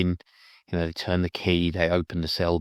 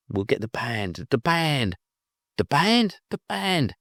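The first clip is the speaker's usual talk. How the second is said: each "the band" climbing much higher; rising excitement; 7 semitones higher than usual. Retold or imitated. Jake's epiphany about reuniting the band, climbing excitement. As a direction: build the repeats upward in pitch.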